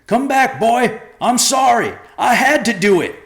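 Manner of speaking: high, loud shout after him. A narrator's reading. Joe shouting after his fleeing son, high and loud. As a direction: call out into the storm. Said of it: "Joe shouted after him".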